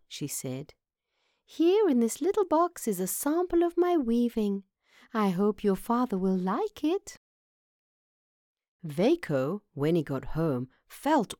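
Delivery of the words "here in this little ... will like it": high-pitched small mouse voice; sweet little mouse. Acted. Mouse hands Vaco her weaving, sweetly hopeful. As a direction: present a gift in a high, dainty voice.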